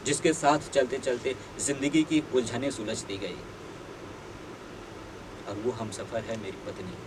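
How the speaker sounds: ordinary talk, nothing marked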